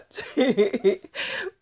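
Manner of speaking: breaks into a high giggling laugh; a real burst of high laughter. Real, not acted. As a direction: laugh out loud at her own secret.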